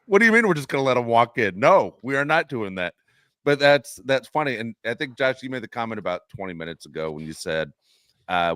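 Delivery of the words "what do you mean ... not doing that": loud, higher mock outrage; outraged bettors. Imitating bettors who backed the Chargers, loud mock outrage. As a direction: play the furious bettor, hammed up. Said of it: "people lost their mind ... were going".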